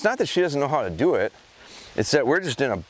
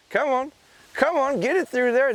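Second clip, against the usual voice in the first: much higher, bright coaxing call; encouraging call; 13 semitones higher than usual. Real, not acted. Trainer urging his dog on, high coaxing. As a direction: coax the dog to keep coming.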